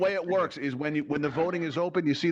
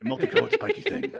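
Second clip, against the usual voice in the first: silly high laughing voice; 3 dB louder than usual. Acted. Host hams up spiky thing.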